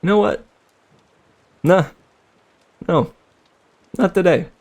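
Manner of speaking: mock defiant underling quitting; defiant refusal. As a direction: shrug off the boss with a cocky no. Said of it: "just decides".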